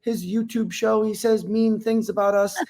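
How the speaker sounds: sarcastic imitation of pretend victims; mocking fake fear. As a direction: parody them whimpering about how scared they are. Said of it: "they told ... the police, like".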